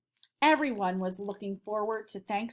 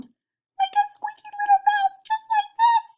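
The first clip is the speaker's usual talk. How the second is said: very high, loud squeaky mouse voice; teacher demos a high-pitched squeak; 10 dB louder than usual. Shown as a demonstration. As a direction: squeak like a tiny little mouse. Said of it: "make a high-pitched sound".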